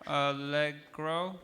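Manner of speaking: allegro said slowly, rising like a question; gentle check. Shown as a demonstration. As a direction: slowly ask allegro back to them. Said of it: "then a leg can grow".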